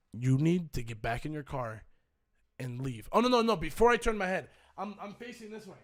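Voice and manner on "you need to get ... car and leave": low, measured order; retold low-voiced threat; restrained warning. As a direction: warn him off with controlled menace. Retold cold warning to Andreas.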